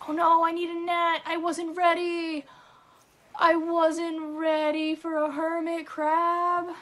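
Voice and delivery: loud, high, frantic cry; panicked shout. Real, not acted. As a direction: yell out in sudden panic. Missed catching a hermit crab.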